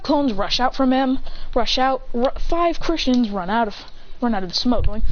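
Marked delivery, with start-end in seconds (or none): none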